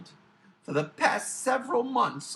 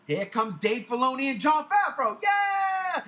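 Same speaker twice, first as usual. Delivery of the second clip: hype introduction; 4 semitones higher, 3 dB louder than usual. Retold or imitated. Host introducing star guests to the crowd, exaggerated hype. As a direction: announce it, rising to a high held cheer. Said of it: "you introduce your guests".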